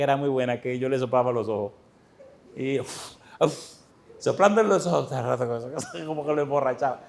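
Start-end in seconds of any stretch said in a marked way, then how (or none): none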